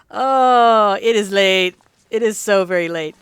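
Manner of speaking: sleepy words through a yawn. Real, not acted. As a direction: let a yawn pull the words. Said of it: "quit yawning".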